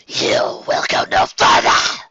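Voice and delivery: slow, rasping creature snarl; a menacing hooded beast's growl. A narrator's reading. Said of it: "called in a snarling voice".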